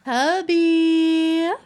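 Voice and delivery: loud, high call for hubby. Real, not acted. Gamer calls her game husband, loud high call. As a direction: shout across the room for him.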